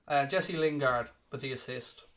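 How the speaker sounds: usual speaking voice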